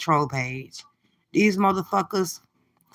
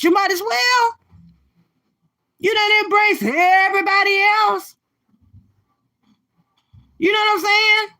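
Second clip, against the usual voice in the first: mocking goading; 11 semitones higher, 4 dB louder than usual. Goading a rival to hook up with anybody, high mocking. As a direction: egg her on in a high, jeering holler.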